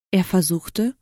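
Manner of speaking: ordinary delivery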